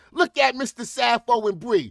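normal talking voice

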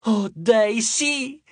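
high-pitched squealing fangirl; swooning high shriek. A narrator's reading. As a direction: squeal it high like a thrilled nymph. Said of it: "strillò una ninfa".

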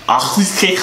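mock German. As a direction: bark it out in fake German. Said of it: "I'm about to speak German right now".

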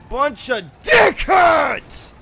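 slow, high shouted insult; drawn-out shout at players; angry yell. Real, not acted. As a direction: bellow it, stretching the words.

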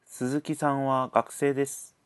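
ordinary talk, nothing marked

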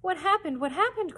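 high, loud and frantic; frightened cry. A narrator's reading. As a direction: call out in alarm, clinging to him. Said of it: "cried Randy".

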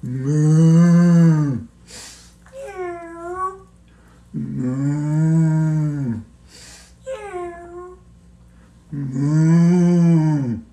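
long drawn-out lowing moo; mock cow moo. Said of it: "sounding like a cow".

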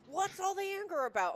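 high, loud imitated partner; quoting her partner's question: high, loud imitation; mock questioning partner. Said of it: "And he's like".

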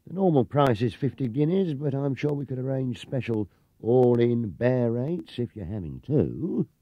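wheedling a special deal; smooth salesman. Acted. Clerk quoting prices to Paddington, smarmy.

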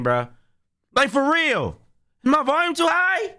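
loud frustrated yelling; screaming streamer. Real, not acted. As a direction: shout it, fed up with the game. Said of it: "I'm screaming at everything".

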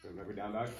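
ordinary talk

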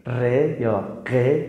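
ر یا ق drawn out slowly as a throaty French uvular r; slow uvular r from the back of the throat. Shown as a demonstration. Teacher demonstrating the French r to students: slow uvular. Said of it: "حرف".

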